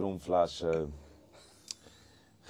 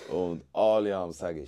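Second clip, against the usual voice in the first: ordinary talk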